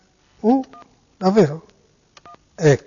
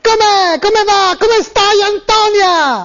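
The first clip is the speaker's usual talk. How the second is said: mock-tearful high voice; high pitched wailing voice; 5 semitones higher, slower than usual. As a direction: wail it in a raised, tearful voice. Prank call, high pitched voice.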